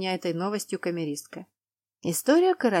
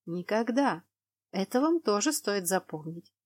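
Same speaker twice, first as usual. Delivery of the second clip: ordinary delivery, nothing marked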